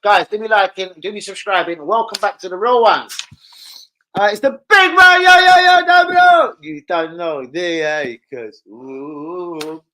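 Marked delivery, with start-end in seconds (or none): 4.2-6.6 very high, loud hype intro; hype shout. Real, not acted. Streamer bellowing his big man intro to viewers. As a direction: yell it like a stadium hype man.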